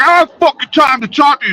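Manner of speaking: high-pitched, rushed mock yell; worked-up retold outburst, quick and high. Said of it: "He starts yelling at me".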